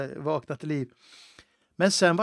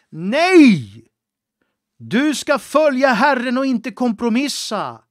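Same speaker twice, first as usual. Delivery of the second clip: rising, insistent preaching, louder toward the end; stern exhortation; 6 semitones higher, 9 dB louder than usual. Acted. Preacher warning listeners not to give in, building to a loud cry. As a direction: urge them not to give up, building in force. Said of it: "jag hoppas jag inte har varit för hård".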